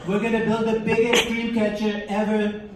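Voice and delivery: lightly voiced blustery politician; a hint of a boastful rally speech; mild mock campaign bluster; Donald Trump impression. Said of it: "what, like, Donald Trump would have been like".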